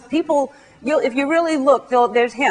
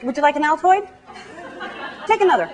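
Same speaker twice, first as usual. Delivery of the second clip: mock-polite offer, pitched higher as it goes on; pointed hinting; 3 semitones higher than usual. Hinting to someone with bad breath, pointedly mock-polite offer. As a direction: offer mints with growing insistence. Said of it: "you can try to hint".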